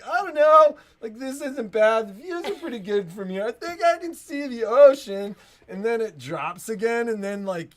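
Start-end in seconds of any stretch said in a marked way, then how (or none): 0.0-5.4 pleasantly surprised rider. Pretend. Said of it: "you're like".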